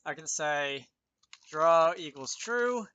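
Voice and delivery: ordinary talk, nothing marked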